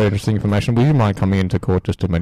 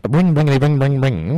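higher, louder sing-song bringity ring; mock ringtone; 6 semitones higher, 3 dB louder than usual. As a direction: bounce it like a silly ringtone. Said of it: "very interesting ringtone".

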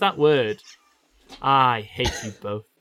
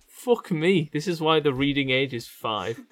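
usual speaking voice

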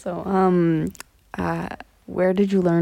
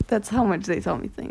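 normal talking voice

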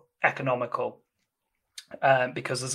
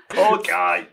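higher, louder, through laughter; laughing; 7 semitones higher, 6 dB louder than usual. Real, not acted. Co-host pitying the doomed colleague, laughing. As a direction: say it while cracking up. Joking over the murdered colleague.

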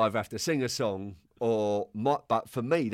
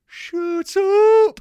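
shrill mock manager, much higher and louder; high squeaky gaffer voice; 11 dB louder than usual. Manager shouting at late player, high mock voice. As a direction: squeak it out like an angry little boss. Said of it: "He had this voice".

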